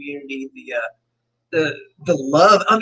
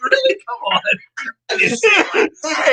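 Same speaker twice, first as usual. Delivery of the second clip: much higher, laughing denial; mock indignant protest. Real, not acted. Friend teased on stream, laughing high-pitched denial. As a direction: squeal it out in playful disbelief. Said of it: "He said it exactly like that".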